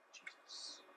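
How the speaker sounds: breathy whispered name. Acted. Pastor whispering the question to the church. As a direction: let the name fall to a whisper.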